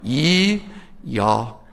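"Ye" unrounded, "y'all" without the L; Southern pronouns. Shown as a demonstration. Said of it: "Here's how you say it".

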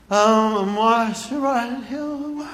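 put-on slow, halting little-kid answer; toddler voice. Three-year-old answering the big kids, slow and awed. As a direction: play a dazed three-year-old in awe.

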